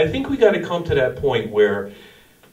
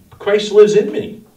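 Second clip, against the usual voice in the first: 1 fervent proclaiming; 9 semitones higher, 9 dB louder, slower than usual. Acted. Preaching Paul's key words.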